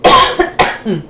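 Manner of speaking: harsh cough; coughing fit. Real, not acted. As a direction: hack out a cough. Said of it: "coughing fit".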